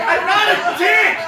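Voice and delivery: loud high protest; indignant shout. Retold or imitated. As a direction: insist you're not one, yelling. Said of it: "And I'm like".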